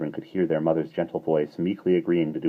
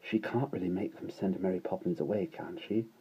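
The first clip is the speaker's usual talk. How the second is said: put-on soft, worried boy's voice; anxious hushed voice; 2 semitones higher, 10 dB quieter than usual. A narrator's reading. Michael whispering worries to Jane, hushed. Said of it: "in an anxious whisper".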